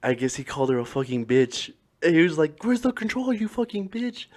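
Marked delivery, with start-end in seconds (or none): 2.6-4.3 high, softened mock outburst; imitated irate son-in-law. Retelling a fan cursing his mother-in-law, mock outrage. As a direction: put on a high voice for a mock rant.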